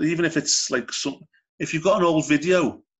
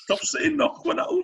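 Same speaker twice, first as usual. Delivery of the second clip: laughing protest; slower than usual. Real, not acted.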